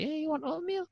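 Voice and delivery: high, soft teasing offer; lightly mock him in a high voice; high quiet teasing question. Mocking the doorman.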